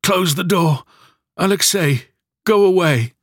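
high, loud, anguished cry; mother in labour ordering her son away, anguished and loud; terrible voice. A narrator's reading. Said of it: "she said in a terrible voice".

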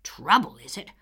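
put-on angry old man. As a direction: bellow the grievance. Furious neighbor berates Anne.

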